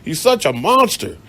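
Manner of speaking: high, loud mock outrage; mock outraged cops. Police condemning the man, in mock outrage. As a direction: ham up their disgust at him. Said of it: "They was like".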